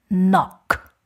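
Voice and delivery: ordinary delivery, nothing marked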